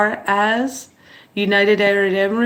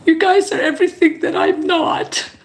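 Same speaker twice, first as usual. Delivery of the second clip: tearful; 7 semitones higher than usual. Real, not acted. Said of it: "you have made me cry".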